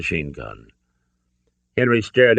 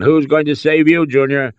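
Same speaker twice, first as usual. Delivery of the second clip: raised, higher-pitched angry father; roaring; 9 semitones higher, 7 dB louder than usual. A narrator's reading. Father rebukes his son at gunpoint, roaring. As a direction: roar it at your wayward son. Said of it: "Henry roared".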